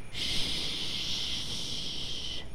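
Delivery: soft unvoiced shh; quiet shush. Shown as a demonstration. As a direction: hush them gently. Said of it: "you should whisper".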